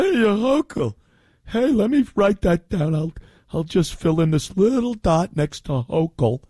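lightly voiced clueless voter; cheerfully oblivious mock voter; mock dim voter. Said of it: "And you're gonna go".

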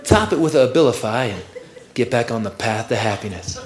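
low, soothing announcer pitch; commercial voiceover guy. Acted. Voiceover guy pitching Abilify to viewers, low and soothing. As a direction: sell the pill in a deep, calm ad voice. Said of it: "And the voice comes on".

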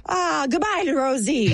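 high-pitched, hammed-up Irish lilt; mock Irish accent. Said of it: "I don't do an Irish accent".

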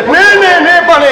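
loud, high cry of objection; shouted, high-pitched "no, no"; alarmed protest. Acted. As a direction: cut in urgently at high volume. Urgently corrects the official's mistake.

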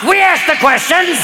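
put-on slow barked German-accented threat; a hint of a mock Gestapo voice; mock German interrogator. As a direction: play a stern German officer.

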